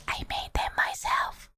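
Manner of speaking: whispered shyly; whispered aside. Real, not acted. Streamer whispering to chat about her emotes. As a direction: breathe it as a secret.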